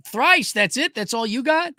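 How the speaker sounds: high, scoffing taunt; mocking scoff. Real, not acted. Streamer mocks the viewer's complaint with a high scoff. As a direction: sneer at the small number.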